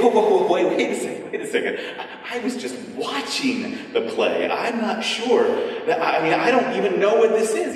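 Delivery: high, loud alarmed protest; panicked protest. Retold or imitated. Visitor protesting when told to go on stage, alarmed. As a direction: backpedal in sudden alarm. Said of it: "And you think".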